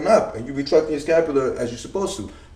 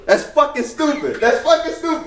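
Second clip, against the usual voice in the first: mocking voice; 11 semitones higher than usual. Mocking imagined lifters.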